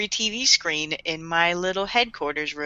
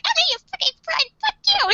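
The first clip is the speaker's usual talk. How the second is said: much higher, shrill-free squeaky outrage; mock outburst. Misa snapping at Takeda, mock high-pitched outburst. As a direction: fling the insult in a high mock fury.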